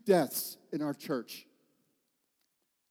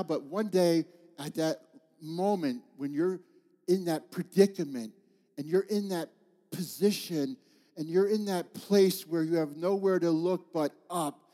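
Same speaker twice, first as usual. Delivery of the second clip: normal talking voice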